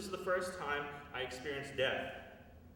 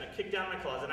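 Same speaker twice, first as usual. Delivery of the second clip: ordinary delivery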